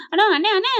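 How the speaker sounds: calling out. Retold or imitated. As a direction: call after him as he leaves. Malar calls after someone.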